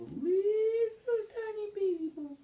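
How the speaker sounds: very high, squeaky little voice; high-pitched baby-small squeak; teeny-tiny voice. A narrator's reading. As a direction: shrink the voice up high for something tiny. Voicing the tiny baby bowl.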